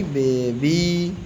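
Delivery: बेबी said slowly, both B sounds clear; slow teaching बेबी; slow spelled-out word. Shown as a demonstration. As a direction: stretch बेबी out for the class. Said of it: "B A B Y".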